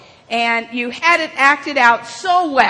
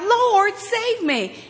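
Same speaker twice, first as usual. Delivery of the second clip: high imitated plea, no real shout; mildly hammed-up cry for rescue; retold cry for help; 8 semitones higher, slower than usual. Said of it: "and he cried out".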